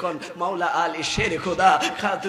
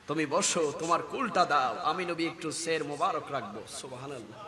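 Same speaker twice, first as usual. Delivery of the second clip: very soft, lowered voice; hushed request; 4 semitones lower, 8 dB quieter than usual. Retold or imitated. Preacher as the Prophet asking Ayesha, hushed. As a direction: fade away gently.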